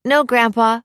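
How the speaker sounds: put-on cheerful little-boy voice; happy child. A narrator's reading. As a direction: answer grandpa gleefully. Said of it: "Timmy said happily".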